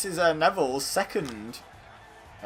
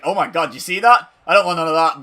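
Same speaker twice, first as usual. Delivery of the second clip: louder, higher mock alarm; alarmed reaction; 5 semitones higher, 12 dB louder than usual. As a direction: voice a startled wrestler wanting no part of it. Acts out Neville's startled reaction.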